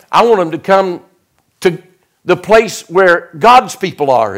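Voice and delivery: high-pitched, fired-up pulpit delivery; forceful preaching. Real, not acted. Preacher vows to win kids back from travel ball, forceful preaching.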